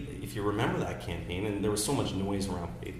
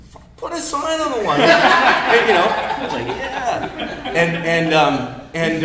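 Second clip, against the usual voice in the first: high, loud laughing through the quote; 13 semitones higher, 14 dB louder, slower than usual. Retold or imitated. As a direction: crack up while quoting him. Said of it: "He said".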